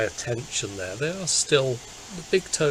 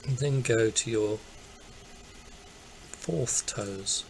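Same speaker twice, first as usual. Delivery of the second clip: calm meditation voice, easing softer; guided meditation; 6 dB quieter than usual. Acted. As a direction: gently lead attention through the body, leaving long pauses. Leads a guided body scan.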